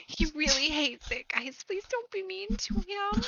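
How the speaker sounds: high, pleading sister voice; pleading fan. Acted. Fan begs the band to be kind to her brother, pleading.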